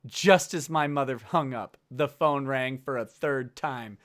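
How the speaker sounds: low, measured audiobook read; deliberate, solemn narrator; story narration. A narrator's reading. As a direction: read it out gravely and evenly. Reads book aloud.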